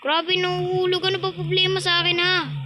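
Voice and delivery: high-pitched, exasperated whine; exasperated complaint. Real, not acted. Gamer keeps falling and asks what's wrong, exasperated. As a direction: complain in a high, frustrated rush.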